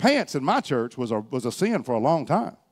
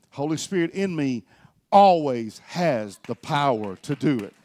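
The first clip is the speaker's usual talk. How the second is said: normal talking voice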